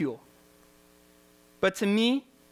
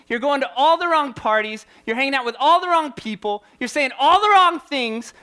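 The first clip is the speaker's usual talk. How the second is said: loud, driving preacher cadence, louder and higher; preaching at full force; 6 semitones higher, 5 dB louder than usual. Acted. Youth pastor preaching loudly to teens about searching. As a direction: hammer each question home to the crowd.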